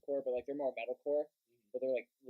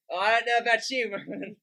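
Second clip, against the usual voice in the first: loud, raised imitation of a South London singer; mock South London delivery; South London accent; 6 semitones higher, 9 dB louder than usual. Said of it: "he sounds so fucking South London".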